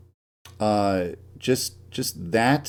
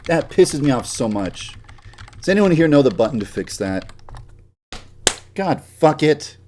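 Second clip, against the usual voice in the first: ordinary talk, nothing marked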